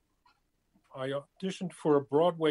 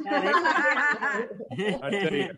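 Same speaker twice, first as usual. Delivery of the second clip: laughing; 10 semitones higher, 5 dB louder, much slower than usual. Real, not acted. Laughing at wacky heritage.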